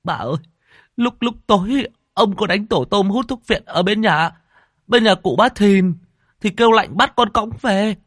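high, loud tearful account; frightened servant. A narrator's reading. Servant tells mistress how master fell in river, frightened. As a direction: shaken boy telling it all.

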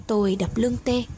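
usual speaking voice

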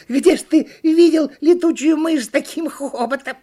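very high, loud monkey chatter; high-pitched monkey jeer; teasing monkey. A narrator's reading. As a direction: squeal it mockingly as a cartoon monkey. Monkey figure speaks.

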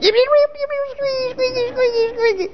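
tiny squeaking critter voice, very high; squeaky little things. Retold or imitated. Mocking puny humans in a tiny high squeak. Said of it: "these little squeaky things down here going".

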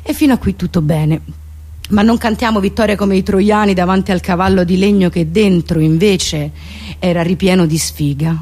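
ordinary delivery

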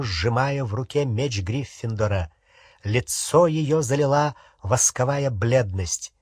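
ordinary delivery, nothing marked

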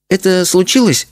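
put-on high-pitched little girl owning up; confessing child. A narrator's reading. Daughter owning up to parents, confessing. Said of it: "решила признаться Леночка".

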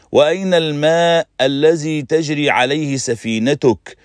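mock his ship with open disbelief; scornful mockery. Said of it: "فقالت في سخرية شديدة". (سؤال ساخر بسخرية شديدة ونبرة أعلى)

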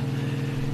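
quick breathy laugh; a little knowing laugh; short laugh. Acted. Wesley laughing before teasing the officer.

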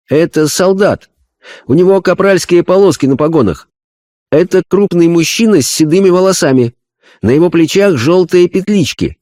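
high, earnest medium voice; a spirit medium describing a vision; seance medium. Acted. (голос медиума на сеансе)

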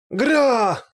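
loud, high cry on being struck; pained roar. A narrator's reading. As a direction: cry out from the blow. Огр вскрикивает от удара. (громкий вопль боли)

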